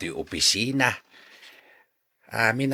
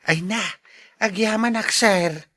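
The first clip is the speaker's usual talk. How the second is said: much higher, louder gushing thanks; overjoyed thanks; 10 semitones higher, 5 dB louder than usual. Acted. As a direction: pour out relieved gratitude. Janitor receives the money.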